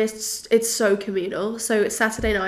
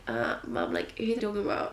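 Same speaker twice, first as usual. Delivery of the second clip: put-on bored kid; 8 dB quieter than usual. Imagined kids reply to her.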